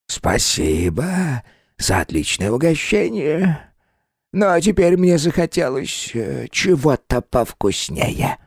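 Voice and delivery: slow, high, eerie hungry spirit. Acted. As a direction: savor each word greedily. Well spirit wants more.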